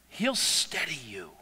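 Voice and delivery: ordinary talk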